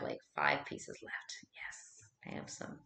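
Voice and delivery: unvoiced whispered aside; soft whisper while folding laundry. Real, not acted. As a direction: whisper it to yourself.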